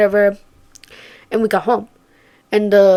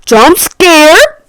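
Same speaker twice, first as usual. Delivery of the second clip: loud, high startled cry; mock horror shriek; shocked shout; 9 semitones higher, 13 dB louder, much slower than usual. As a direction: yell it like a jump scare just hit. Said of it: "And I was like".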